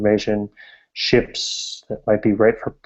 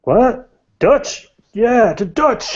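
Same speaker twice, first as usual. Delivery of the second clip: high, drawn-out cheering patrons; excited tavern cheer; 13 semitones higher, 4 dB louder, much slower than usual. Acted. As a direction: raise a glass and cheer.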